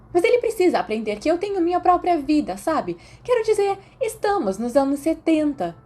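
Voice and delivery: put-on high and fast, self-assured girl; gushing Mary. A narrator's reading. Mary lecturing Remus on her own life, high and fast. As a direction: rattle it off, pleased with herself.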